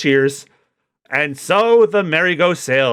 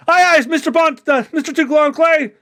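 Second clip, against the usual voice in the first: eager underling; 12 semitones higher than usual. Acted. Crew answers Mister Two.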